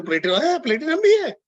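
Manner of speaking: high-pitched, loud mock astonishment; hammed-up startled discovery; acted surprise. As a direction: blurt it in put-on disbelief, leaping up high. Acts out surprised, unprepared seller.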